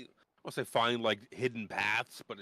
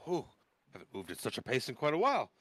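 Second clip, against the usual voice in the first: higher, huffing pleased mutter; Dalamar voice; 7 semitones higher than usual. Acted. Dalamar hurrying along with the party, high breathless chatter. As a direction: puff along, delighted with the pace. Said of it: "Dalamar's all along the way is a little like".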